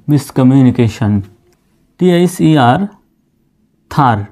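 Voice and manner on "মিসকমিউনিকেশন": মিসকমিউনিকেশন said as one smooth word, a bit slow; teacher putting the full word মিসকমিউনিকেশন together; whole word blended. Shown as a demonstration. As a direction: join it all into মিসকমিউনিকেশন. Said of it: "সম্পূর্ণ উচ্চারণটা এরকম আসতেছে".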